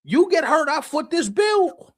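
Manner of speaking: high-pitched, hammed-up owner outrage; exasperated team owner. Owner to player about injury costs, exasperated. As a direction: protest the cost, voice climbing, last words drawn out.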